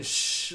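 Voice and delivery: a loud hissed Shh; loud shush. Retold or imitated. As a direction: shush the teenagers from the front row. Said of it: "like, loud".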